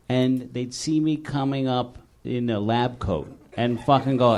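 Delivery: ordinary delivery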